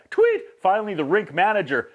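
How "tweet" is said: high, sharp vocal "tweet" like a whistle blast; quick shrill-free whistle chirp; whistle imitation. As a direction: mimic the coach's whistle with your voice. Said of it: "tweet, again".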